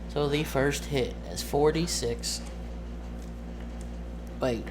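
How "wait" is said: ordinary delivery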